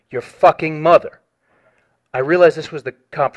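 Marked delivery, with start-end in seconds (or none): 0.1-1.2 slower, louder mock snarl; a retold threat, slow and louder; more aggressive retold insult. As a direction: lean into the insult, heavier this time. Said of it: "he repeated more aggressively".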